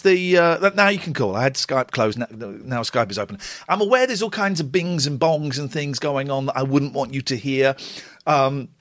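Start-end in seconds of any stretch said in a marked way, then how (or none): none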